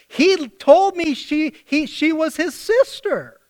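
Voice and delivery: a flustered ruler pleading innocence. Retold or imitated. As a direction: whine that he was lied to. Ruler protesting to God.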